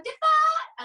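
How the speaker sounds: high belted word. Shown as a demonstration. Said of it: "really at the back of my throat".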